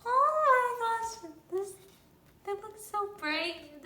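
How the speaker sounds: high, loud, slow squeal. Real, not acted. Fan reacting to the band photo, high slow squeal. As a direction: melt into a delighted squeal.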